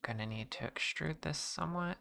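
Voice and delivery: soft whispery ASMR voice; breathy soft-spoken ASMR voice. Pretend. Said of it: "that's enough A S M R voice for me".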